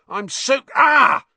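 high, loud sudden cry of shock; startled yelp. Acted. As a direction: yelp as cold water hits. Balloon bursts on Ron's head.

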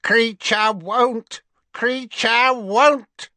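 put-on high, slow croak of a bitter old servant; croaking house elf. A narrator's reading. House elf Kreacher croaking his refusal at Harry. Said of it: "croaked the house elf".

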